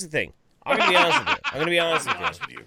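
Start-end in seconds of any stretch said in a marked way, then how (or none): 0.6-1.3 high, loud, laughing through the words. Real, not acted. Laughing confession. 1.4-2.6 suppressed laugh. Real, not acted. Second try at confession.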